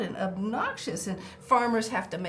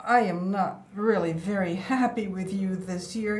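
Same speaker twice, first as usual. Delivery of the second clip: ordinary talk, nothing marked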